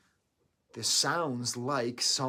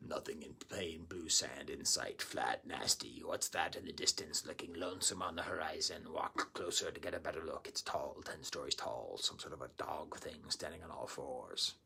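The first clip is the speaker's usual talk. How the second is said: lower, softer whispered read of the notes; hushed reading; 7 semitones lower, 7 dB quieter than usual. Acted. Reading out the album's spoken words in a soft whisper. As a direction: breathe the lines like a secret.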